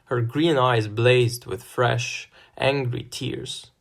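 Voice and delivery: plain reading voice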